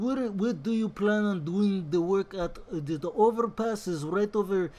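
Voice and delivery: imitating an accented Bond villain; mock movie-villain voice with a foreign accent. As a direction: talk roadwork like a foreign supervillain. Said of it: "he sounded like a Bond villain".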